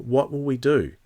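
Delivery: usual speaking voice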